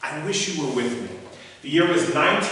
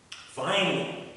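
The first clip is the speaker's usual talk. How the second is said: usual speaking voice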